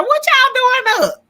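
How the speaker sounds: hammed-up disbelief at the late crowd; mock surprise.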